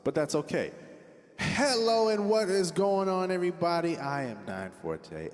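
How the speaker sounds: normal talking voice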